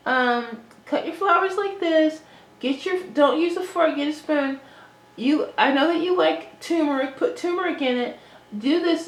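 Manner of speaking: mock someone telling you how to do chores; mimicked bossy instructions. Imitating a woman bossing her around.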